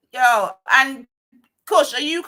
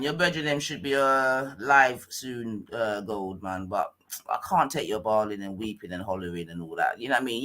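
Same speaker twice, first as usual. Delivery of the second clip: usual speaking voice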